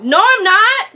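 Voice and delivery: high, loud protest; indignant denial. Real, not acted. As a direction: shoot back in protest. Bickering over being a millennial.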